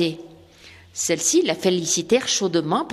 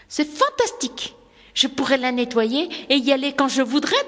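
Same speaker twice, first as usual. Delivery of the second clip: put-on much higher, delighted girl's voice; thrilled; 5 semitones higher than usual. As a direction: burst out with joy. Rosemary thrilled by house. (fillette ravie, voix beaucoup plus aiguë)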